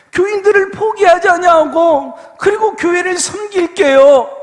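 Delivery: loud, ringing promise to the Lord; fervent prayer vow. Acted. Pastor reliving his vow to the Lord, fervent loud prayer. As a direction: vow it with everything you have.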